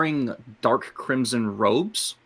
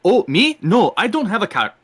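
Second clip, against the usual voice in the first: high, loud protest of innocence; startled denial; 10 semitones higher, 8 dB louder, faster than usual. Acted. Stranger caught at someone's cart, flustered denial. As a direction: pitch jumps up, caught off guard.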